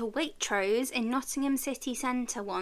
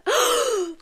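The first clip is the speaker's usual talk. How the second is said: sharp excited gasp; 14 semitones higher, 6 dB louder than usual. Retold or imitated. Starstruck by being recognised.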